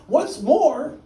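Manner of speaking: high, loud snap; the Lorax, his dander up, snapping; snapped. Acted. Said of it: "snapped the Lorax".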